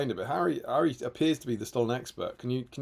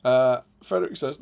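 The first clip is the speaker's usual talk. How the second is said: normal talking voice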